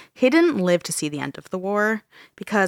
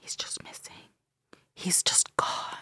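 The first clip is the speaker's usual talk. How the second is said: spooky whisper; 12 dB quieter than usual. Acted. Spooky ghost story reveal.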